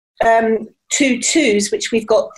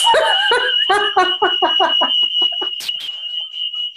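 high laugh. Real, not acted. Laughs at the alarm.

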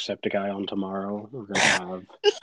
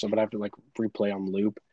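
normal talking voice

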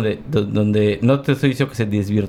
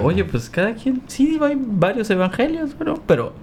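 mock carefree; 9 semitones higher than usual. Voicing a carefree attitude.

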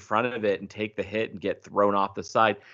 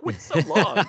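incredulous question through a laugh; laughing disbelief; 4 semitones higher than usual. Retold or imitated. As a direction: laugh at how flat the farewell is. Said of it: "I was like".

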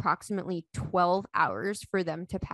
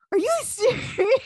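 high, loud question broken by laughter; laughing disbelief; 7 dB louder than usual. Retold or imitated. Wife retelling prank standoff with husband, laughing in disbelief. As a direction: laugh through a retold incredulous question.

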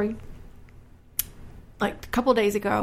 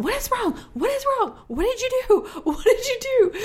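put-on high, panicked questions to her child; alarmed mom; 13 semitones higher than usual. Mother to her crashing toddler, high alarmed pleading. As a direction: rush the questions out, much higher and frightened. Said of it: "and I'm going".

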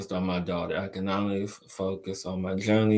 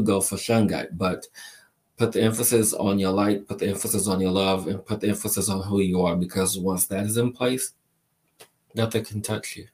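ordinary talk

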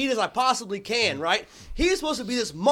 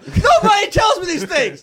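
mock complaint; 8 semitones higher, 9 dB louder than usual. Real, not acted. Mocking a friend's complaint.

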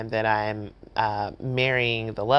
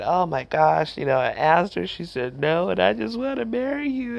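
brokenhearted plea; 6 semitones higher than usual. Retold or imitated. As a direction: imitate a heartbroken man begging to marry. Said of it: "He was brokenhearted".

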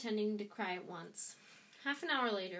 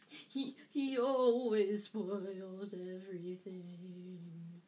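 slow whiny pretend sob; fake sobbing; 4 dB louder, much slower than usual. A narrator's reading. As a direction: drag it out whining. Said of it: "between huge pretend sobs".

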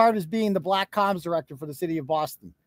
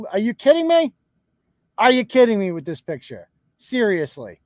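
exasperated disbelief; 6 dB louder than usual. Real, not acted. Streamer reacts to the nurse's picture, exasperated. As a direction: burst out loud and incredulous.

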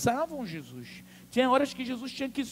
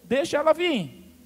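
raised, loud order; commanding call; 6 semitones higher, 8 dB louder than usual. Retold or imitated. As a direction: call out over the crowd to let someone through. Jesus manda deixar a mulher passar. (ordem em voz alta)

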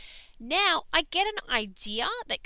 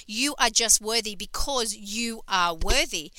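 usual speaking voice

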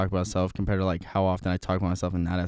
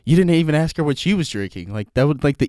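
high, loud, incredulous telling-off; exasperated scolding; 6 semitones higher, 6 dB louder than usual. Retold or imitated. Buddy mad that I never asked her, imitated exasperation. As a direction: imitate a friend mad at your blunder. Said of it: "He was so mad at me".

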